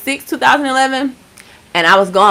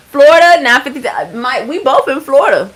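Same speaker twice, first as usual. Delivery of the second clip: excited; 5 semitones higher, 3 dB louder than usual. Real, not acted. Viewer shares her home state.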